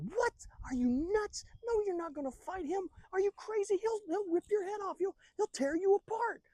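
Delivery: very high, frantic squawking protest; hammed-up alarm at the top of the range; aghast disbelief. Brothers stop David fighting, aghast.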